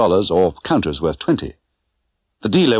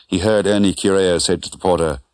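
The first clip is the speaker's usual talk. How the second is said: ordinary delivery, nothing marked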